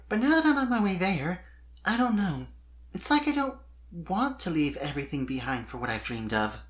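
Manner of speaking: wistful confession. A narrator's reading. Sweetie Belle confides her doubts.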